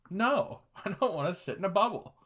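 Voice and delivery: high-pitched comic protest; hammed-up indignant objection; indignant refusal. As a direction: refuse it outright, pitch jumping way up. Comic refusal of bubble yoga.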